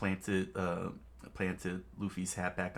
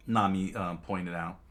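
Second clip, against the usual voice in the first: plain reading voice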